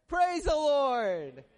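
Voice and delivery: drawn-out pulpit shout; exultant praise. Real, not acted. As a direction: call out praise to the whole congregation. Preacher calls out praise.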